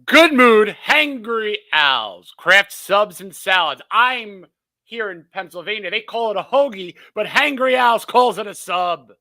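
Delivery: loud, slowed sponsor pitch; host reading the sub shop ad. Acted. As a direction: punch the brand name like a commercial. Sponsor ad read.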